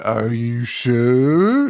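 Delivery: very slow, drawn-out words; exaggerated, lingering question; mock suspicious question. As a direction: stretch every word in a put-on teasing query. Retold TV hosts teasing her.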